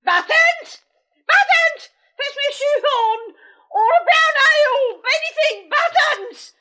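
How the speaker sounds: high, loud calling out; shouting. Acted. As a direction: yell for the servant. Sister yelling for servant.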